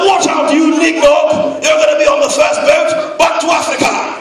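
high, loud slow yell. Retold or imitated. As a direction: bellow the insult. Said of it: "it goes like this".

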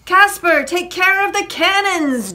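high, loud commanding call; captain's orders. A narrator's reading. Jack sends Casper to the cannons, commanding call. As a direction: call orders across the deck.

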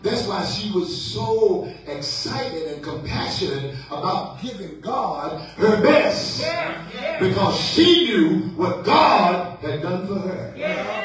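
emphatic church preaching, key words stretched. Acted. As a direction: drive each phrase home to the congregation.

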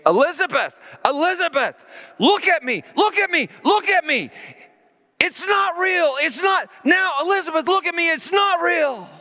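urgent pleading shout. Retold or imitated. Father calling to his terrified daughter, high urgent shout. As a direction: shout to break through her panic. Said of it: "I started screaming".

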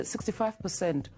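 ordinary talk

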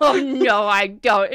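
loud hearty denial; jovial merchant. Acted. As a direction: laugh off her doubt heartily.

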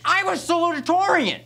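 high-pitched indignant outburst; desperate protest. Acted. Rejected applicant boasting of his grades, desperate protest. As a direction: voice leaps way up in wounded protest.

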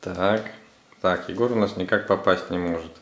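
usual speaking voice

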